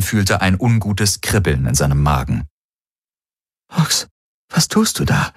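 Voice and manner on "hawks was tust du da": hushed, voiceless whisper. A narrator's reading. Kirai to Hawks as weapons point at him, whispering. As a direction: whisper it so only he hears. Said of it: "hörte er Kirai flüstern".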